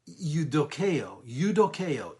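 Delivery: "eudokeo" said slowly as yoo-doh-KAY-oh; careful Greek pronunciation of "eudokeo"; Greek word said carefully. Shown as a demonstration. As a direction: sound out "eudokeo" in clear syllables. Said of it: "E U D O K E O".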